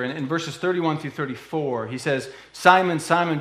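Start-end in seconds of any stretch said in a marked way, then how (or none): 2.5-3.4 urgent call. Acted. Jesus calling Peter by name.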